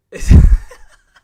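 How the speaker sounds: sudden loud burst of laughter; big real guffaw. Real, not acted. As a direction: crack up helplessly. Bursts out laughing at accent guesses.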